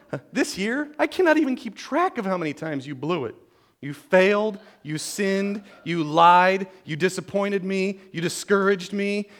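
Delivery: hammed-up parental telling-off, pitched high; playfully exaggerated parent's tirade; mock scolding. Mock scolding of child at Christmas.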